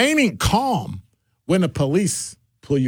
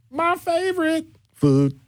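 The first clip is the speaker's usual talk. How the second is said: teasing; 12 semitones higher, slower than usual. Acted. Teasing upcoming topic.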